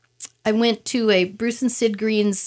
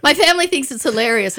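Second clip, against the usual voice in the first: ordinary talk, nothing marked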